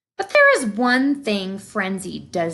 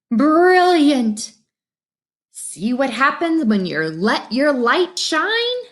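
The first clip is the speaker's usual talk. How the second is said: high, louder cheering; delighted praise; 5 semitones higher, 4 dB louder than usual. Acted. Friends praising Frenzy at the art show, delighted. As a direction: cheer her on.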